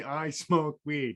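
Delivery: slow, deliberate words to repeat back; slow teaching demo. Shown as a demonstration. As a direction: say it slowly like a lesson to copy. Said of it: "I repeat after me".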